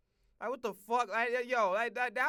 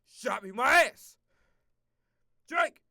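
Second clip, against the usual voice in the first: loud, punched-out shout of outrage; sudden acted holler at the shooter; mock outraged yell; 10 dB louder than usual. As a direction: yell it out like you've just been shot. Imagines himself shot, returning fire.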